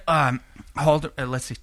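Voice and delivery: ordinary talk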